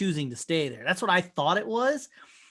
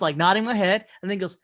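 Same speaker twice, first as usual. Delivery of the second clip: ordinary delivery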